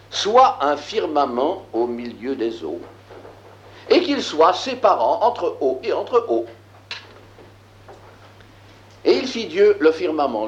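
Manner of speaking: measured, declaiming biblical verse; solemn scripture reading. Acted. Teacher reading Genesis aloud to the class, solemn scripture reading. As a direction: read it like sacred text, weighty.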